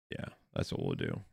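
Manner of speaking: very low gravelly mutter; deep growl. Pretend. Muttering fix plan to self.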